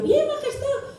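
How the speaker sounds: high, timid squeak; squeaky reply. Retold or imitated. Comic answering the queen in a high squeak.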